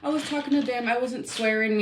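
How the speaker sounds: mock innocent excuse. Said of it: "she's like".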